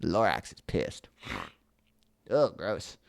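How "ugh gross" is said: plain reading voice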